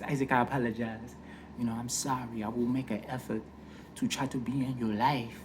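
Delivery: put-on soft, measured African-accented father; smooth African accent. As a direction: apologize as a smooth-voiced African dad. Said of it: "He had a real smooth voice".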